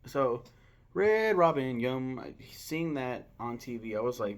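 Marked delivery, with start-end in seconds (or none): 0.9-2.2 jingle catchphrase. Pretend. Ad-style catchphrase.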